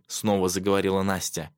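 plain reading voice

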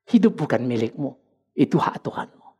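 low, hushed divine pronouncement; lightly voiced word of God, kept low; scripture voice. Preacher quotes the Bible to sufferers, low and subdued. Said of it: "Maka Alkitab bilang".

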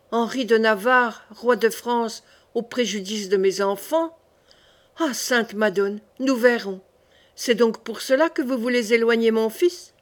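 ordinary delivery

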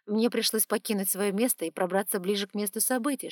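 usual speaking voice

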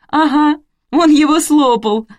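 high, loud, gleeful exclamation; triumphant, stretched-out delight. A narrator's reading. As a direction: crow it out with joy, high and loud. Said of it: "обрадовалась я".

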